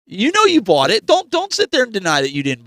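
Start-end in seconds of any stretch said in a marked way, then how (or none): none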